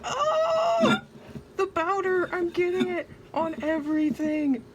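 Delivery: high, loud shriek of alarm; panicked scream. Real, not acted. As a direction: shriek as powder flies. Powder spraying everywhere.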